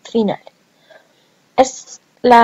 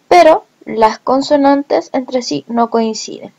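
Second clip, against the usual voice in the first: normal talking voice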